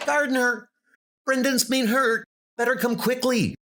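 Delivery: alarmed messenger. Acted. Messenger rushing to tell Gardner Brendan is hurt, high alarmed voice. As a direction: burst in with bad news, high and hurried.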